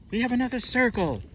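high excited announcement. Real, not acted. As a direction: cheer it out. New circle taken, excitement.

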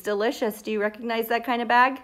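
plain reading voice

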